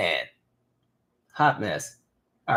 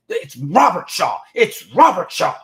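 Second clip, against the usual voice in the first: slow, louder, higher stern teacher voice; stern teacher correcting; 6 semitones higher, 6 dB louder, slower than usual. Retold or imitated. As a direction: spell out the name like an irritated old teacher. Teacher correcting his name.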